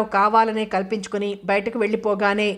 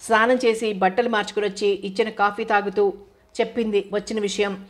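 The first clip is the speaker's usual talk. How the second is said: ordinary delivery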